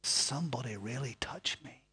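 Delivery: soft, low whisper; hushed whisper. Acted. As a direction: lean in and breathe it quietly. Jesus whispering after shouting.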